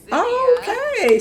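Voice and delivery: squeaky drawn-out okay; high disbelief. Real, not acted. Streamer reacts to Sim's crush, high and incredulous.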